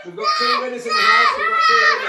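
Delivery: loud, high bingo caller announcement. Pretend. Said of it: "the bingo caller".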